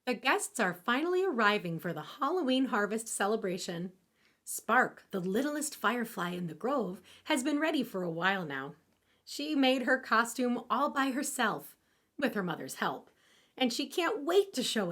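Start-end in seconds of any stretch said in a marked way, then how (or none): none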